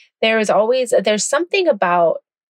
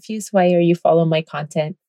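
ordinary delivery

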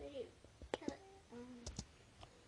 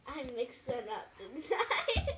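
laughing; 9 dB louder than usual. Real, not acted. Realizes mistake, laughing.

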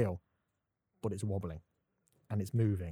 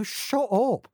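high-pitched mock dismissal; exasperated retort; 9 semitones higher than usual. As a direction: cut the silly wizard off, exasperated. Acted retort to fantasy character.

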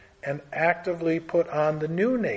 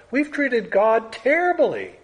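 raised preaching voice; emphatic declaration; 7 semitones higher than usual. Real, not acted. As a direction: announce it to the whole room. Preacher stating a shared wrong.

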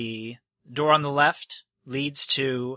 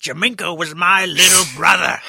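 indignant nobleman; 4 semitones higher, 8 dB louder than usual. Acted. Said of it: "NPC correcting".

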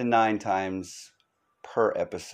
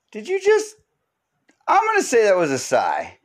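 squeaky, much higher mock voice; shocked friend; 12 semitones higher, 3 dB louder than usual. Host imitates someone catching a dog's smell in a squeaky voice. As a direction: pipe up in a shrill disbelieving squeak.

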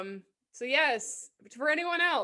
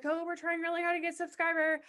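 pleading tone; 7 semitones higher, faster than usual. Retold or imitated. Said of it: "I was like".

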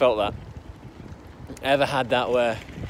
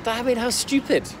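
scornful; 9 semitones higher than usual. Retold or imitated. Retelling scornful reaction to dad.

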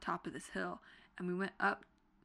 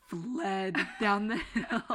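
laughing; 3 semitones higher, 6 dB louder, slower than usual. Real, not acted. Laughing at escape.

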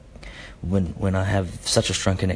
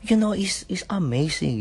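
put-on Filipino accent, higher pitch; 11 semitones higher than usual. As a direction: slip back into the Filipino lilt. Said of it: "switch between Filipino and Aussie accents".

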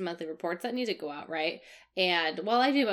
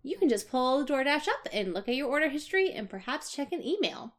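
chirpy customer service voice; 6 semitones higher than usual. Retold or imitated. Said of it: "I keep my chirpy customer service voice".

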